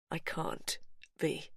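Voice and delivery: quiet, nearly breathless; soft refusal. Acted. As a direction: let her down gently. Brother refusing softly.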